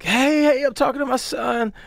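high-pitched imitation of a frantic woman. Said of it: "This girl comes up, frantic".

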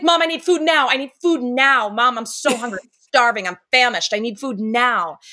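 put-on high, loud demanding child; whiny hungry kid. Voicing her hungry son nagging mom for food. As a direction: beg for food like a starving toddler.

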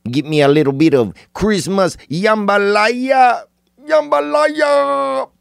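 slow, soaring comic squeal of relish; playful high-pitched crowing. Acted. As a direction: climb very high and stretch the dish's name. Playful food call, voice soaring.

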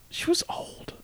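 hushed whisper, barely voiced. Real, not acted. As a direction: whisper it so nobody hears. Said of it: "Why are we whispering that?".

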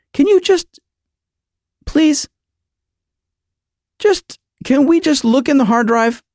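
high, pleading and exasperated; pleading exasperation. Retold or imitated. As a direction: beg through clenched patience. Begging the agent, losing patience.

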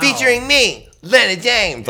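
lady voice pitched high. Retold or imitated. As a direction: go up high, girly and sweet. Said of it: "you had your much higher lady voice on it".

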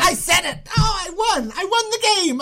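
high-pitched, elated crowing; real triumph. Real, not acted. Celebrates guessing right.

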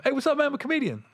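high-pitched, over-eager fan greeting; mock-chirpy approach to a star. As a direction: pitch it up like a pushy hopeful introducing himself. Imagined fan accosting a celebrity.

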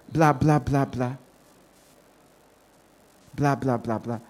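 dismissive. Real, not acted. Mocking gossip, trailing off.

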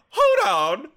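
loud, high mock outburst; theatrical interruption. Rehearsing a way to halt a questioner, loud and dramatic. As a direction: cut in dramatically at the top of the voice.

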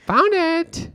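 very high mimicking echo; teasing falsetto-like repeat; mocking echo. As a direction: parrot her triumph in a high voice. Son mimics mother's triumphant line.

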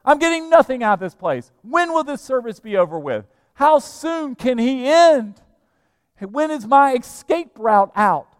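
loud, high-pitched exasperated complaint; fed-up churchgoer. Acted. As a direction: act out someone desperate to get out. Voicing a restless, complaining churchgoer.

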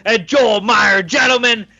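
loud, high-pitched hype announcer; hype podcast intro. Acted. Host welcomes listeners to the hockey gambling podcast, shouted hype intro. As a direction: belt out the show opening with big energy.

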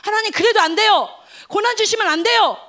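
high, loud desperate plea; retold prayer begging God, loud and pleading. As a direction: beg God not to do it. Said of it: "그 전에는 어떤 마음이었냐면".

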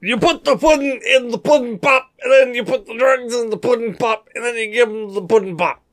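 high, sing-song pudding-pop pitchman parody; Bill Cosby. Acted. Podcaster joking about pudding pops, exaggerated Cosby impression. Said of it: "instead of channel Cosby".

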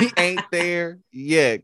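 laughing through the words; can't hold back the laugh. Real, not acted. Laughing while telling a friend to stop. Laughing repeat of own line.

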